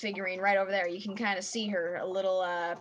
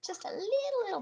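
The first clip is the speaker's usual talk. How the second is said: squeaky; 13 semitones higher, much faster than usual. Pretend. Teasing after jump scare.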